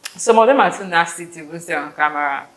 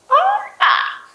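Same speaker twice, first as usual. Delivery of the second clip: high excited shriek; 6 dB louder than usual. Real, not acted. Excited village memory.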